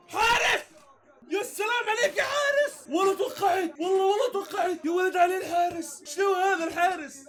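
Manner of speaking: high, shouted praise for the keeper; excited cheering. Real, not acted. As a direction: cheer the keeper's save at full voice. Cheering a great save.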